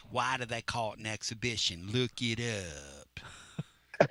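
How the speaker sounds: soft retort ending in a very long stretch; [name]. Retold or imitated. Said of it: "Sounds like a Pruitt impersonation".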